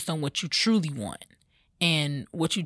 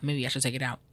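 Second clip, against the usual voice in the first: usual speaking voice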